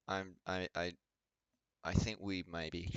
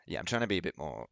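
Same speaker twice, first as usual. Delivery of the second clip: ordinary talk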